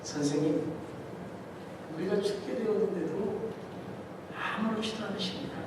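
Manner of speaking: soft, a touch of hurt reproach; hushed complaint. Acted. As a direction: quietly ask if he even cares. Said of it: "예수님께 말합니다".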